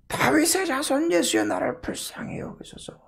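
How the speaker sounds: very high, strained cry, falling away to voiceless. Acted. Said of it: "더 크게 소리 질러요".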